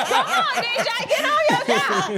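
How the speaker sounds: high-pitched, quick call-out. Real, not acted. Host prods the DJ mid-show, high playful call-out.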